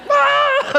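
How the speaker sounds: very high, loud mock scream; short shrieked imitation of labor pain. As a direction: shriek out a pretend pain cry. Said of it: "It was a scream like".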